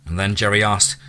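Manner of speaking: ordinary delivery, nothing marked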